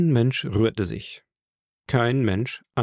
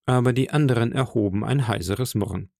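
usual speaking voice

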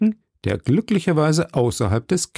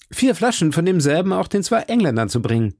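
higher-pitched reminder called out; calling after someone; 7 semitones higher than usual. A narrator's reading. D'Artagnan orders bottles from the departing innkeeper, calling after him. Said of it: "rief D'Artagnan ihm nach".

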